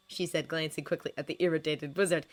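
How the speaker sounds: usual speaking voice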